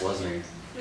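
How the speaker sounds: ordinary delivery, nothing marked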